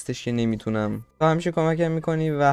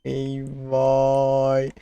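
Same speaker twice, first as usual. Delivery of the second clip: ordinary delivery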